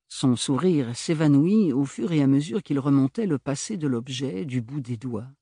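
ordinary delivery

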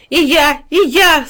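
high, loud yelps of joy; joyful barking. A narrator's reading. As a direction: burst in like an overjoyed dog. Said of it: "залаял от радости пёс". (радостный лающий возглас)

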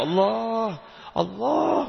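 restrained mock shout, pitched high; demonstrated calling out. Shown as a demonstration. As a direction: call out upward, drawing out the name. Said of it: "Cuman teriak Allah aja".

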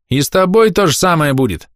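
put-on high, threatening boy's jeer; cheeky shout. A narrator's reading. Boy threatens the old woman, cheeky shout. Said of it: "нахально крикнул один из мальчишек".

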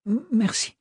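high, faltering voice stammering "M-, merci"; shaky frightened thanks. Acted. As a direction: stammer it out, voice breaking. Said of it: "ne put s'empêcher sa voix de chanceler".

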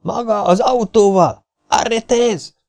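high, slow shout after the car; calling out. A narrator's reading. Custon calls the driver to stop, high slow shout. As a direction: stretch the call to stop him. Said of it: "kiáltott Custon".

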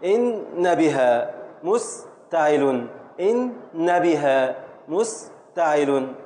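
"إن بها" matched to "مستعل" slowly; line up the word with its foot, slowly; slow scansion. Shown as a demonstration. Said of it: "teacher repeats scansion slowly". (تقطيع بطيء مع التفعيلة)

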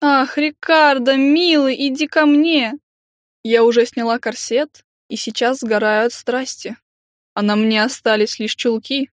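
hammed-up swooning lover; languid seductive sighs. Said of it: "с томным придыханием".

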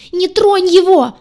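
very high, loud, slow screech; shrill shriek. Acted. Redhead shrieks at the heroine to let go of him. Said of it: "ещё истошнее завизжала рыжая".

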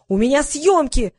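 demanding his rights. A narrator's reading. Vadim pushing his claims at Liza, demanding. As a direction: protest with stretched, insistent words. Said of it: "начал качать права Вадим".

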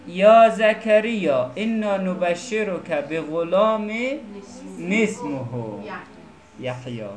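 slow, measured Arabic recitation; careful teacher's recital of scripture; Quran recitation. Shown as a demonstration. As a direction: recite with long held vowels.

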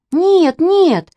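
high, loud protest; crying out. A narrator's reading. Two girls contradict their papa, crying out. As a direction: cry out in alarmed denial, pitched well up. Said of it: "закричали Катя с Маней".

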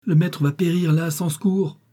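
high, desperate lament; distraught moan. A narrator's reading. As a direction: cry out helplessly for the master. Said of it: "gémit Saurin, éperdu".

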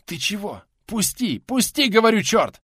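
high-pitched protest rising to a loud demand; alarmed protest. A narrator's reading. Man trying to tear his wrist free, alarmed protest. Struggling to break free of grip.

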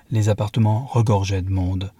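ordinary delivery

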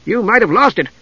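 light, high, eager kender voice; bright boastful young kender; Tass the kender. A narrator's reading. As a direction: play him chirpy and proud. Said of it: "Tass said".

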